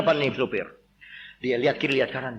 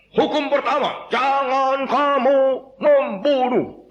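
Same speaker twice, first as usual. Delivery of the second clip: slow, loud, high recital; drilled commandment; 7 semitones higher, 3 dB louder, much slower than usual. Acted. Missionary drills villagers on commandments in slow loud chant. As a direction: spell it out slowly for the villagers. Teaching commandments slowly, loudly.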